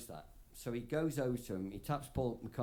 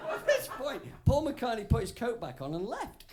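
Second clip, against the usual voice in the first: laughing; 13 semitones higher, 5 dB louder than usual. Real, not acted. Laughing at the punchline.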